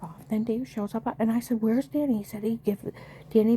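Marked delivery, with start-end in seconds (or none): 1.6-2.2 urgent demand. Retold or imitated. Demanding son back from husband.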